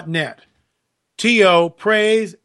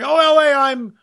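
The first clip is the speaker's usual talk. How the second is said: higher-pitched mock greeting from a fan; lightly voiced eager introduction, pitched up; imagined fan greeting; 8 semitones higher than usual. Fan coming up to jog the host's memory, high mock greeting. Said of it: "come up and you gotta jog my memory".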